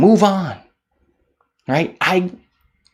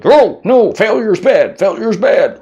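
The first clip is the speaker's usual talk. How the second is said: higher put-on whiny voice, mock alarmed; mocking society's voice; 10 semitones higher, 3 dB louder than usual. Speaker mimicking society to viewers, whiny high mock voice. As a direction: parrot the world's panic about failure in a silly high voice.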